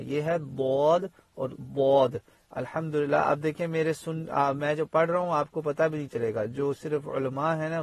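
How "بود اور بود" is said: بود and بود said slowly, the first and the second each with its own heavy Arabic dad; careful recitation demo of بود and بود; two dad sounds demonstrated. Shown as a demonstration. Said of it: "یہ زواد دواد نہیں ہے".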